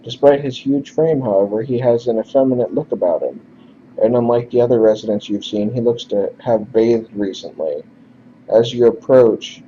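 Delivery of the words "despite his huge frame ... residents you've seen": ordinary talk, nothing marked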